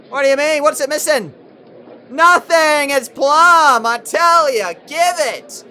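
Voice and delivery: high, loud outraged protest; indignant yelling. Real, not acted. Arguing a not-out LBW with the umpire, indignant yelling. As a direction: yell at the umpire to reverse it.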